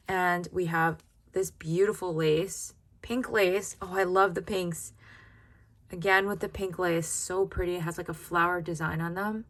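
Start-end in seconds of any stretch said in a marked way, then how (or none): none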